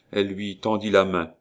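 plain reading voice

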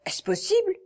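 high-pitched gasp of surprise; surprised exclamation. A narrator's reading. Chevalier learning who was killed, surprised. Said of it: "s'écria le chevalier avec surprise".

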